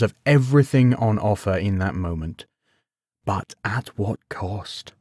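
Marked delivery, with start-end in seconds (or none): 3.3-4.9 slow, softer inner voice; a quiet inner warning, drawn out slowly. A narrator's reading. The voice in Gawain's head warns him, slow and quiet. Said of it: "asked the voice in his head".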